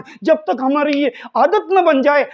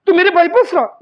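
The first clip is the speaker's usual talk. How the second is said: higher, louder challenge, playfully indignant; mock confrontation; 5 semitones higher, 4 dB louder, faster than usual. Teacher playing offended brother to a class, mock confrontation. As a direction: act out taking offence for your brother.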